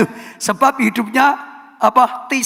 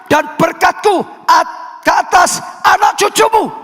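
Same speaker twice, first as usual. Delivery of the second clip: high, shouted, fast run of proclamations; fiery preaching; 9 semitones higher than usual. Acted. Preacher proclaiming God's cleansing to the congregation, shouted. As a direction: hammer each phrase like a revival call.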